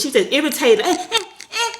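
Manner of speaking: put-on high, whiny fussing toddler noises; imitating toddler whine. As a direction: mimic a fussy baby squirming. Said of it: "That's how she sounded".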